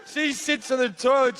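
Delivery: ordinary talk, nothing marked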